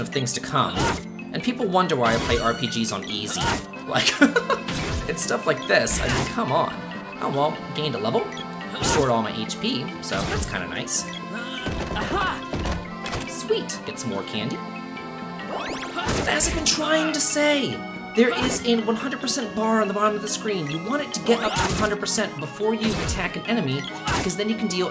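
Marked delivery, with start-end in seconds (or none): 4.2-5.0 high, airy chuckle; amused laugh. Real, not acted. Laughs at own struggle. 16.3-24.9 mock-exasperated insistence. Real, not acted. Resumes repeatedly interrupted explanation.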